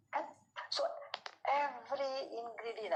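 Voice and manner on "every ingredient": "every" slowed and pitched higher, stressed. Shown as a demonstration. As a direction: draw out "every" for emphasis. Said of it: "I'm emphasizing on the word every ingredient".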